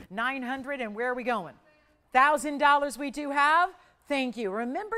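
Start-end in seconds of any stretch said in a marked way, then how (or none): 2.1-3.7 loud, high call of the thousand; excited bid call. Acted. Host hailing the thousand dollar bid, loud and excited. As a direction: announce the thousand with a lift.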